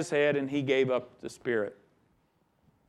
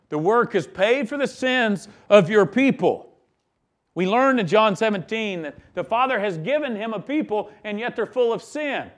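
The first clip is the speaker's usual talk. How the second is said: usual speaking voice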